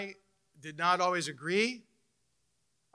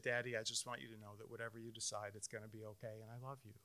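put-on softer, gentle voice of a fourteen-year-old; daughter's tender words; 6 semitones lower, 17 dB quieter, faster than usual. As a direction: reassure her worried father softly. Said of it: "And she said".

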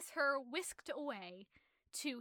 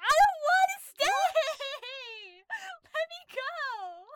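high, slow, wailing cry half laughing; wailing shout; 14 dB louder, much slower than usual. Retold or imitated. Maid wailing to the master to let her go, high slow cry. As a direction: wail it out like a desperate girl with a laugh. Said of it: "she's like".

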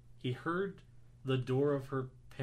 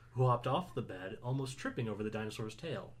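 ordinary delivery, nothing marked

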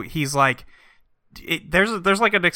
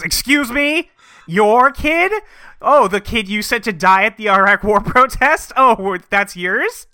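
high, loud mock outrage; indignant, incredulous rising questions; big mad; 7 semitones higher, 5 dB louder than usual. Furious wife to husband claiming the dead son, big mad. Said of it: "she's big mad".

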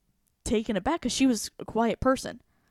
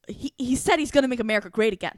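higher and louder, an irritated mock of the slogan; angrier retort; 4 semitones higher, 6 dB louder, faster than usual. Imitating the angry classmate's slogan reply. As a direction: mimic the classmate getting angrier. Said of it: "more angry with me and said".

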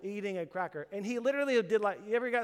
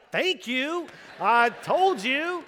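louder, higher and slowed right down; vindicated outburst; 6 semitones higher, 8 dB louder, much slower than usual. Retold or imitated. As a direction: drag out "I told you" in triumph. Vindicated husband to nurse.

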